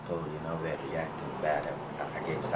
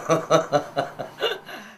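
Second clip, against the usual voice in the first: hearty laugh; 9 semitones higher, 9 dB louder than usual. Real, not acted. Laughing about the word free.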